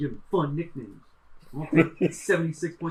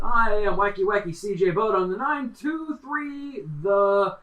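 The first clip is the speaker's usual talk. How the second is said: radio producer voice; 4 semitones higher, 3 dB louder than usual. Acted. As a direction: put on an over-the-top radio producer voice. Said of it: "weird radio producer voice".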